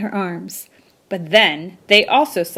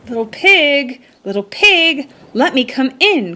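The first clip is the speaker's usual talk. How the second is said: a hungry shark calling out, higher and urgent; anxious shark calling; 5 semitones higher, 4 dB louder than usual. A narrator's reading. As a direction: call anxiously up to the door, higher. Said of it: "called the hot and hungry magic shark anxiously".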